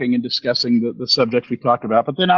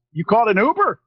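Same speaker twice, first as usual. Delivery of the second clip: puzzled taxi driver; 9 semitones higher than usual. Retold or imitated. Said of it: "the guy looks at me and goes".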